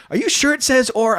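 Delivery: mock her doubt, high and loud; doubting wife.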